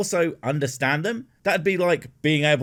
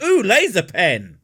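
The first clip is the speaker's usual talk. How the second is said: higher, louder sudden excitement; distracted delight; 7 semitones higher, 6 dB louder than usual. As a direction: snap to the shiny thing. Cat distracted by laser pointer.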